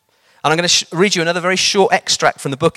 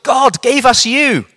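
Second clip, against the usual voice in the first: put-on old lady amazed her prayer is answered; old lady telling the preacher he is God's answer, high and joyful; overjoyed old lady; 8 semitones higher, 5 dB louder than usual. A narrator's reading. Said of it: "one old lady trilled".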